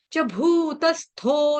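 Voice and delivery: slow, with an aspirated थो in भूतस्थो; careful pronunciation. Shown as a demonstration. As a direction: stress the breathy थो of भूतस्थो, not तो. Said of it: "थो के बदले तो बोला जाता है". (भूतस्थो में थो साफ़, तो नहीं)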